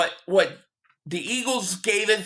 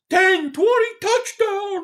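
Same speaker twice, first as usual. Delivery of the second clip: excited touchdown call; 12 semitones higher, 3 dB louder, slower than usual. Real, not acted.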